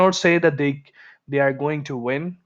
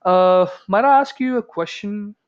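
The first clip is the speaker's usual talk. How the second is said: higher-pitched, courteous request; very polite; 6 semitones higher, 4 dB louder than usual. Shown as a demonstration. Junior jumping into seniors' debate, very politely. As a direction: cut in very politely, pitch lifted. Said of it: "very polite request".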